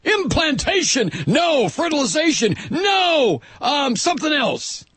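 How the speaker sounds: high slow hammed-up screaming; mock the shrill debate voices; mock screaming zealots. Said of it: "screaming about when life begins".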